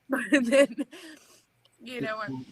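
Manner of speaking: trying not to cry, failing. Real, not acted. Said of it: "I always say I'm not gonna cry".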